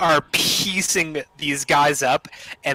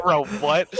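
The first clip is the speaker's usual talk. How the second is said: breathy laugh on bro, what; half laughing, half baffled; amused disbelief; 8 semitones higher than usual. Real, not acted. Reacting to absurd line.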